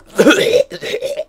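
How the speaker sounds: put-on loud hacking old-man cough; imitated old man cough. Mimicking the old man on the bus, loud hacking cough. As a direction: hack it out like a phlegmy old man. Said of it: "you know how old men cough".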